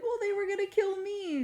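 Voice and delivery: very high little gnome voice; gnomish accent. Acted. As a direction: whine it in a high gnomish squeak. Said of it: "in his little gnomish accent".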